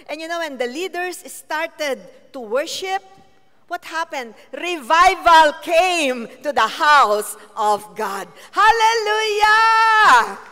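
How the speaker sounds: loud, high shouted praise, stretched out; preaching at full force. Acted. Preacher shouting praise to the congregation, full force. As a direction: rouse the whole church to shout.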